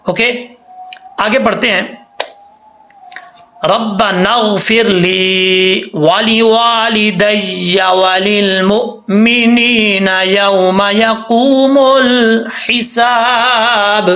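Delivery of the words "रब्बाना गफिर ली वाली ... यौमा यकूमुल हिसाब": slow, melodic tilawat of the dua; chanted Quran recitation; Quranic recitation. Acted. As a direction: recite it slowly like a qari. Recites the verse.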